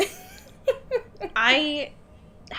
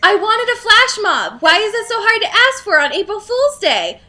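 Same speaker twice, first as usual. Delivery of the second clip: higher and louder, pleading complaint; exasperated whine; 9 semitones higher, 7 dB louder than usual. Real, not acted. Host to co-host, complaining about her awful shift, exasperated. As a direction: wail about the ruined day, cranked up high. Frustrated rant about ruined day.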